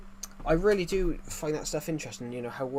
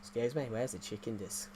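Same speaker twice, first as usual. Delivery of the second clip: softer Kiwi-accented question; New Zealand accent; 5 dB quieter than usual. Shown as a demonstration. Streamer repeats the airport question, soft New Zealand accent. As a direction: put on clipped Kiwi vowels in desk. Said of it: "in a New Zealand accent".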